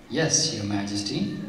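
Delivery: soft, timid reply; fearful quiver. A narrator's reading. Poor woman answers the furious queen, softly fearful. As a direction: answer meekly, as if afraid of the queen. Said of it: "her voice quivering with fear".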